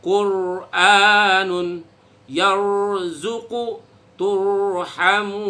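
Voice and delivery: Qur'anun yarzuqu turhamun drawn out very slowly, heavy r; slow Quranic recitation. Shown as a demonstration. Teacher reciting examples for students, slow and heavy. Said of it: "Seperti contoh".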